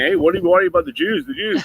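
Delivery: rising, mocking ask; mock questioning. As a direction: put on a high voice for an imagined questioner. Mock question from English people.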